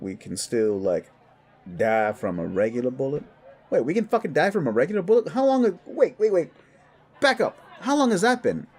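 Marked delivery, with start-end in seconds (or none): 3.7-8.7 high, loud, fast burst of shock; alarmed disbelief. Acted. Werewolf learning ordinary bullets can kill him, alarmed. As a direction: blurt it out in raised-pitch panic.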